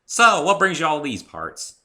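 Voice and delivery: high, loud southern drawl; hearty farm mare welcoming a friend; Applejack's voice. Acted. Applejack asks.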